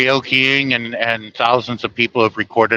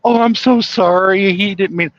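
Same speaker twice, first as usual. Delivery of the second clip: apologetic mom; 9 semitones higher than usual. Retold or imitated. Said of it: "And his mom goes".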